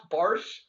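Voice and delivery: Barsh, Bosh said with an R inside; drawn-out Barsh with an added R; Clyde's mispronunciation. Retold or imitated. Quoting the broadcaster's slip on Chris Bosh, R-added Barsh. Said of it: "he pronounced Bar".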